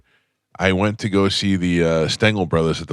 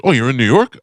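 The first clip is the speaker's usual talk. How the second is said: higher, louder mock caller voice; a hint of a surprised New York pal; imitating Vinny Pastore; 6 semitones higher, 5 dB louder than usual. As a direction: lightly play the excited friend on the phone.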